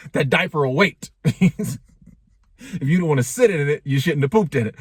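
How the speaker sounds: chuckling through the punchline; laughing. Real, not acted. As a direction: laugh as you say it, amused at your own joke. Laughing at own diaper joke.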